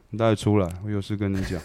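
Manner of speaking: quiet, cold summons with a hint of menace; cold ominous tone. Telling the tattoo artist to step outside, cold and ominous. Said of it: "看，真的是这个语气".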